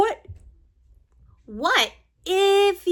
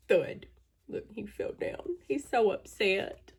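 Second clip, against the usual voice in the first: usual speaking voice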